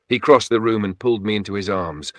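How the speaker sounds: ordinary delivery, nothing marked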